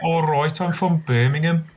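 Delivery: demonstrating a Birmingham twang; Birmingham accent. Shown as a demonstration. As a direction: put on a Brummie accent. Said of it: "you can say, like".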